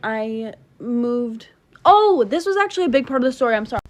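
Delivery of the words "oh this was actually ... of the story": fast, high and loud oh-I-forgot; startled remembering, quick and loud; sudden realization. Real, not acted. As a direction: blurt it out as it hits you.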